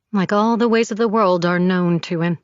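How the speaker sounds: plain reading voice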